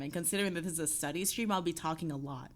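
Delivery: usual speaking voice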